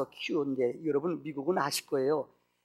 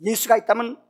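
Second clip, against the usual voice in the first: louder, higher bitter retort; angry outburst; 7 semitones higher, 11 dB louder than usual. Acted. Mother with AIDS snaps at the pastor, angry outburst. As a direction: throw the question back at him in anger. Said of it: "막 욕하는 거예요".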